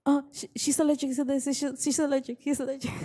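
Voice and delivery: high, hurried mock customer voice; imitating fretful customers. Mimicking customers announcing allergies to staff, high fretful mock voice.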